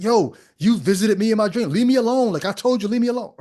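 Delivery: hammed-up irritated phone call; mock annoyed scolding. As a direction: shoo them away, playfully fed up. Mock call telling dreamer off.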